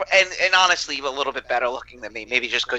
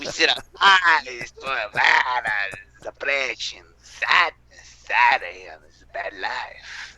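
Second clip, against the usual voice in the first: put-on weepy misery, sinking lower; mock depression; 3 semitones lower, slower than usual. Joker wallowing in cafe depresso sadness, mock-tearful.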